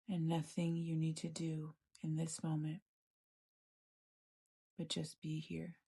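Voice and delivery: calm, even meditation voice with long pauses; guided meditation. Acted. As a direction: gently guide them inward, leaving space between phrases.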